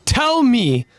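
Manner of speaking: high, insistent demand; frustrated. Acted. Eren demanding answers from Hanji, frustrated. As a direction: press the point in a high, exasperated voice. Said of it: "he's frustrated at her lack of understanding".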